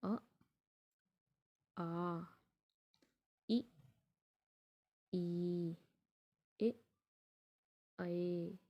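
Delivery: अ आ इ ई ए ऐ each said alone, with pauses between; अ, आ, इ, ई, ए, ऐ said one by one for the class; vowel demo. Shown as a demonstration. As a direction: model each unrounded vowel for students to repeat. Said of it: "जैसे".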